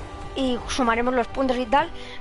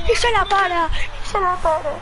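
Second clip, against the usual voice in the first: shocked disbelief; 6 semitones higher than usual. Real, not acted. Disbelief at the save.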